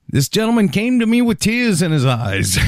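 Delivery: a hint of Trump's voice; Trump impression. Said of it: "Donald Trump is always saying".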